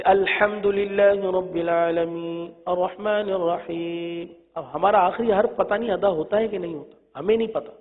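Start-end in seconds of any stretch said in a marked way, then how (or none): 0.0-4.3 slow recited الحمدللہ رب العالمین; slow تلاوت; Quran recitation. Shown as a demonstration. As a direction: recite it as in prayer. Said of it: "example of Fatiha recitation".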